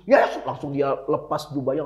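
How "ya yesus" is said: high, loud call to Jesus; mock shouting. Blind beggar calling as Jesus passes, mock shouting. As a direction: call out in a quick high burst. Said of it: "dia langsung teriak-teriak".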